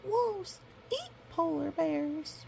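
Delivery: high, slow, tearful cub voice; crying cub. Acted. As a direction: whimper it like a scared cub.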